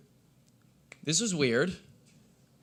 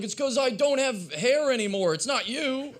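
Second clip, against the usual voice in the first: defensive protest; 11 semitones higher, 4 dB louder than usual. Acted. Acts reply to upset girlfriend.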